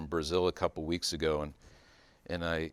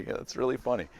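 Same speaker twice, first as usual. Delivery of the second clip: ordinary talk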